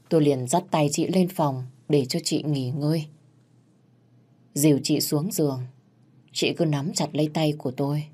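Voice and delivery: plain reading voice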